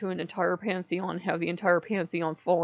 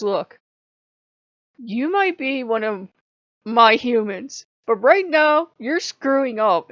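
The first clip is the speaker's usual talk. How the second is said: slow, louder mock telling-off; retold scolding; 3 semitones higher, 7 dB louder, slower than usual. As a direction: lay down the law like a stern god. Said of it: "And yelling at me for five minutes straight".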